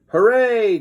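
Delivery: high excited cheer; a bright kid's hooray. A narrator's reading. George cheers for Captain Underpants, excited. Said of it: "cried George".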